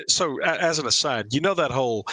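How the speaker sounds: ordinary talk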